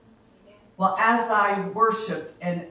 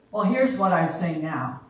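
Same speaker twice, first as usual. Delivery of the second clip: ordinary talk, nothing marked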